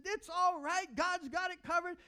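high, loud cheerful calm; happy reassurance. Retold or imitated. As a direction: reassure brightly like the pastor. Quoting happy pastor.

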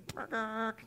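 a far-off bat answering; distant bat hello. Retold or imitated. Bat replying from across the pen, pitched high. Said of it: "you'd hear, from the distance".

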